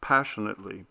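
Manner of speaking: ordinary delivery, nothing marked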